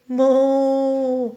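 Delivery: a lowing cow sound; mock moo. Teacher mooing for the toddlers in a mock cow voice. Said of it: "It must be a cow".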